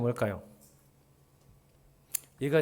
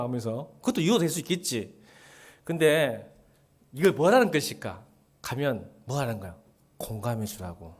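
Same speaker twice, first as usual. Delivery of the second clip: normal talking voice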